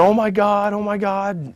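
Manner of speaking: imitating an announcer's excited outcry; high, stretched exclamations; mock amazement. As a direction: hype a shock result. Promoter hyping the upset win.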